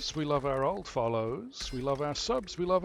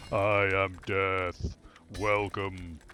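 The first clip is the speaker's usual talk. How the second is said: slow, deep reaper voice; Death from Discworld; 4 semitones lower, 5 dB louder, much slower than usual. Acted. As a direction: intone it slowly like Death. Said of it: "Death from Discworld".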